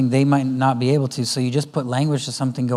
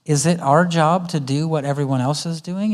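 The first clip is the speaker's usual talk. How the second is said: normal talking voice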